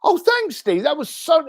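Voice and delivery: high, bright-eyed thanks; mock-grateful contact replying; grateful friend. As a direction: gush a quick thank-you like a pleased friend. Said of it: "people that will go".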